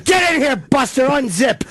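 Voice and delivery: high, shouted comic command; mock yelling. Host ordering the media icon inside, mock shouting. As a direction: bark orders in hammed-up fury.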